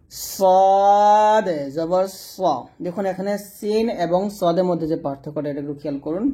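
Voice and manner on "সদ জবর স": সদ জবর স drawn out very slowly with a heavy, thick স; thick sad sound. Shown as a demonstration. Teacher showing students the thick Arabic sad, slow and heavy. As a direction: stretch it out and make the স full and heavy. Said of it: "সদ মোটা হবে".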